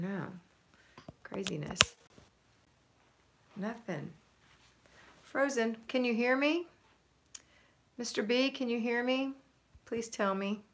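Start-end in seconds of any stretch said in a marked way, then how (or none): none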